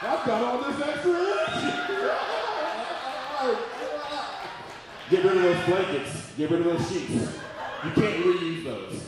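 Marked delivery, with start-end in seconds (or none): 0.0-1.7 higher and higher, laughing, a man amazed at his energy; a boyfriend suddenly pumped up after carbs; sudden burst of energy. Retold or imitated. Boyfriend bragging to partner about surprise energy, laughing excited shout. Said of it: "your man's like". 1.8-5.0 high wheezing laugh at his own joke. Real, not acted. Comedian laughing at his own joke, high wheeze.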